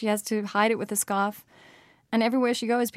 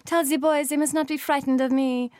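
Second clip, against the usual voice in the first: put-on airy, higher French accent for a dancer; 5 semitones higher, 4 dB louder than usual. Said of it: "crazy French accent".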